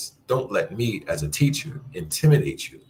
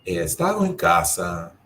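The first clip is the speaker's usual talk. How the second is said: He estado begins much higher; lifted statement; high start statement; 10 semitones higher than usual. Shown as a demonstration. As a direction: open high on He estado then fall. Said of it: "as opposed to making a statement".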